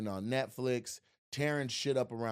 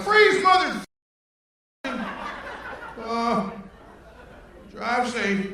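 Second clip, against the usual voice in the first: mock cop; 11 semitones higher, 9 dB louder, slower than usual. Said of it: "the cop was like".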